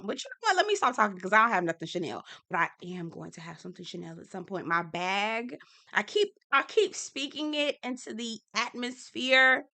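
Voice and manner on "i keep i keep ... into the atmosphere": ordinary talk, nothing marked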